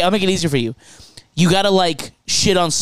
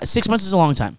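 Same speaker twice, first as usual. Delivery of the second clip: ordinary talk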